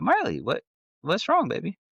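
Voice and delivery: high-pitched, gently worried dad voice; concerned dad. Retold or imitated. Worried dad asks upset daughter.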